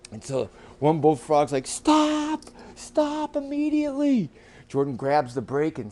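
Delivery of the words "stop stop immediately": high-pitched urgent frog cry; urgent command. Acted. Bullfrog ordering the driver to stop, urgent. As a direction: call a halt in a high frog voice.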